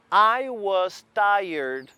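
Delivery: I was tired said very slowly, word by word; slow model sentence. Shown as a demonstration. Teacher modelling I was tired slowly for the class. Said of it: "olha a pronúncia".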